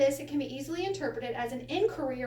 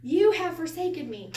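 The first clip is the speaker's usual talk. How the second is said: higher, louder, amazed declaration; victorious exclamation; 4 semitones higher, 7 dB louder than usual. Shown as a demonstration. Teacher voicing Jesus on the cross, victorious exclamation. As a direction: proclaim it high and loud, like a discovery. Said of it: "more like a victorious exclamation".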